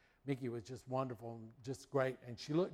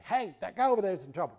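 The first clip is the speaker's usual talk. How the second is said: mock urgent fast call, higher and louder; Lassie's message; 6 semitones higher, 7 dB louder, faster than usual. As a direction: blurt it out fast like an eager rescuer. Dog's message voiced as speech.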